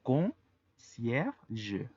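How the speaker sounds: Concierge said clearly in French, zh ending; French model. Shown as a demonstration. Teacher repeats Concierge so students hear it. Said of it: "la pronunciación es concierge".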